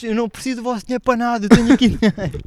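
a reencenar o grito, agudo; gritar irritado. Retold or imitated. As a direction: berro de raiva mais agudo, rápido. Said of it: "estás a gritar assim num restaurante". (repete o grito aos amigos, agudo e zangado)

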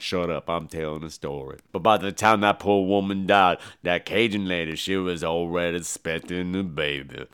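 low, soft storyteller's rumble; deep Tony Todd voice. Retold or imitated. Tony Todd's character tells the backstory, low and soft. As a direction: drop way down and spin the tale low.

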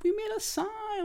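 squeaky falsetto cheer; mocking cheerer. Mocking marathon fans cheering with a sign, falsetto squeal. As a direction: parody a chirpy spectator in falsetto. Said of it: "for the people, like".

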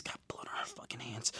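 hushed, dramatic whisper. Real, not acted. Recapping Misty's bloody hands, in a dramatic whisper. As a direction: lean in and whisper it darkly.

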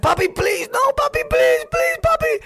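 put-on high-pitched squealing child begging; mock pleading. Kid begging his father during a whipping, mock high-pitched pleading. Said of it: "I'll be like".

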